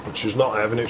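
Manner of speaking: ordinary delivery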